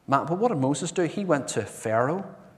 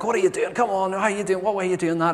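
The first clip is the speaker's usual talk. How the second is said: higher, louder impatient outburst; frustrated snap; 8 semitones higher, 3 dB louder, faster than usual. Retold or imitated. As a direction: lose patience with your wife over the tent. Husband frustrated putting up tent.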